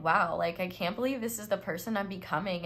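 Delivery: ordinary talk, nothing marked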